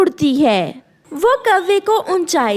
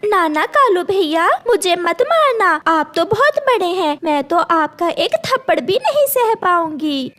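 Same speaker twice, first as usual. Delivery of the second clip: higher, pleading little bird voice; frightened sparrow; 5 semitones higher than usual. Acted. Sparrow pleading with the bully crow, scared and high. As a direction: beg him not to hit you.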